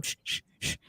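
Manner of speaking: hushed unvoiced shushing of the car; imitated shushing. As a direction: shush everyone in a hiss of breath. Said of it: "telling everybody in the car".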